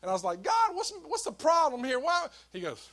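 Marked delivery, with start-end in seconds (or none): 0.4-2.3 high, loud exasperated whine at God; whiny complaint to God. Retold or imitated. Sick preacher whining to God about his cold, high and loud. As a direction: plead at God, pitched way up and loud.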